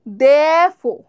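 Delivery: Therefore said "dh-eh-fo", r dropped; therefore demo. Shown as a demonstration. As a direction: swallow the r in Therefore, "defo". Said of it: "Repeat after me".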